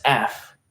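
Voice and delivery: "F" for "if", like the letter's name; London's vowel. Retold or imitated. Streamer repeating how London says "if", accent imitation. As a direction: stretch "if" into a slow "F". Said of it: "She says F".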